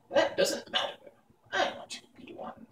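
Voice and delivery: high, soft, unmoved crone; stern witch. Acted. Witch Mombi refusing boy Tip, high and cold. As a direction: dismiss the boy coldly. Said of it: "looking at him severely".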